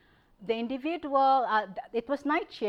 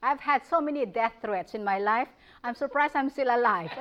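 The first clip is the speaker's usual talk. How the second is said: usual speaking voice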